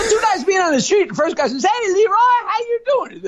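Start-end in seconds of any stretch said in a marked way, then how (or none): 1.6-3.3 much higher, chirpy greeting; high-voiced pal. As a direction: call out to Leroy up high. First guy greets Leroy.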